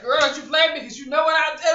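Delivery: loud, high mock mom; playing her mom talking back with a laugh; imitating mom. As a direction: shout it as her sassy mother. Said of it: "And she's like".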